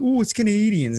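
high, light Canadian accent imitation; mock Canadian accent. Imitating how Canadians talk, in a mock Canadian accent. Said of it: "that's how they talk".

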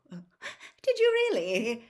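high, loud, drawn-out nervous question. A narrator's reading. Visitor answers the suspicious manager, nervously. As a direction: stretch it out with nervous false interest. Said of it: "said Mister Weasley nervously".

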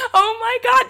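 high giddy laughing; laughing with joy. Real, not acted. Overjoyed after doing the voice.

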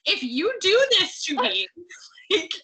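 mock outrage. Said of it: "it made me so angry".

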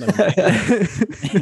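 laughing. Real, not acted. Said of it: "laughing while saying it".

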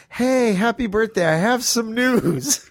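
high-pitched, drawn-out birthday greeting; imitated cheery phone greeting; singsong announcement. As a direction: tease the news in a high lilt. Retold birthday call with news.

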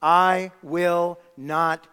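"I will not" slowly, each word set apart and loud; "I will not" as a slow, emphatic vow; confident declaration. Acted. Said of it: "So we say with confidence".

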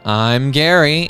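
ordinary talk, nothing marked

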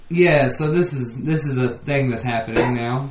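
normal talking voice

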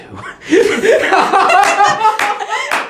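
high, loud, long laughing fit; burst of laughter. Real, not acted. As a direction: crack up loudly at your own punchline.

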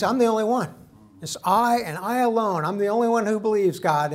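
high, loud, hammed-up protest; Elijah's complaint. Acting out Elijah's lonely complaint.

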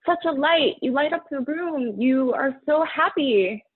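high, lightly mocking echo of the praise; a light mock of friends calling her the life of the room; gushing admirers. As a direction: mimic everyone cheering her on. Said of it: "everybody says is".

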